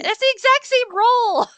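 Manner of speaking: loud, high outcry; exasperated disbelief. Real, not acted. Player seeing an identical dice roll, exasperated outcry. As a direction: exclaim it loudly, pitch shooting up.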